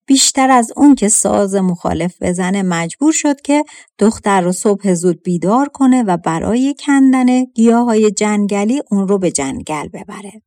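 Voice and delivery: ordinary delivery, nothing marked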